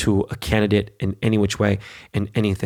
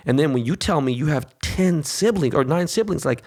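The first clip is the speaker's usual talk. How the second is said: ordinary delivery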